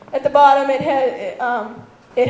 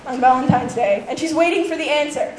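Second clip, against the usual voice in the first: ordinary talk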